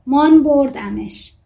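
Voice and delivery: put-on slow Armenian-accented Persian, light touch; Nikola's Armenian accent, a open vowels. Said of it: "لهجهٔ نیکولا که ارمنیه".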